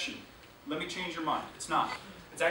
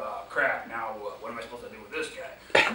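mock dread; 10 semitones lower than usual. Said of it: "We go".

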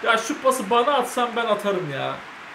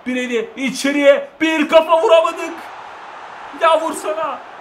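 loud, higher frustrated yell; frustrated shout; 7 semitones higher, 4 dB louder than usual. Real, not acted. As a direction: shout at the missed header. Missed header during match.

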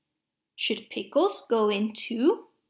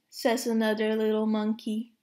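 usual speaking voice

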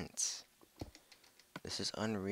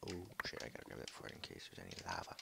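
usual speaking voice

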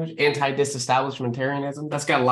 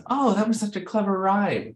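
higher-pitched imitation of an impressed listener; mock admiration; 7 semitones higher than usual. Audience member notices a clever rhyme, mock-impressed. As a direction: lightly act out a delighted reaction. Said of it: "where the audience goes".

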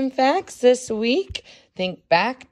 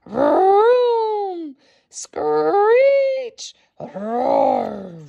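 engine noises; 10 semitones higher, 3 dB louder, much slower than usual. A narrator's reading. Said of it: "I hear rumbling and screeching".

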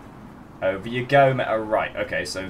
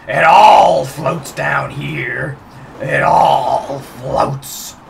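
creepy clown voice; 7 dB louder, much slower than usual. Retold or imitated. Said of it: "They're remaking that".

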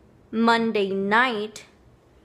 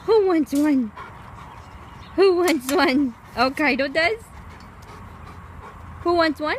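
excited pet talk; 6 semitones higher than usual. Real, not acted. Owner offering treats to her dogs, high excited call.

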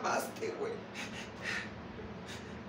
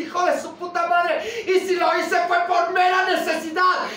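loud, higher, yelling out the betrayal; furious shout; 4 semitones higher, 7 dB louder than usual. Acted. Woman screaming at her ex about her ruined life, furious shout. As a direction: rage at the man who broke her heart.

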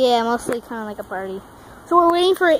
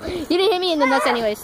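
ordinary talk

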